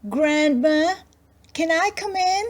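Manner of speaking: put-on girl calls to Grandma through the door, high; little girl calling. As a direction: call through the door like a child. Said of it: "she called".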